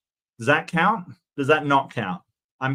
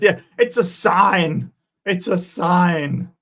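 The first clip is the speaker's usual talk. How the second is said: mock prophetic; 4 semitones higher, 6 dB louder, slower than usual. Mocks the preacher's prophecy.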